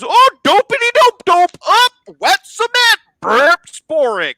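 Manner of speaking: high, loud, silly cartoon robot; goofy robot voice. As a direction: squeal it like a dopey robot. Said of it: "and the robot goes".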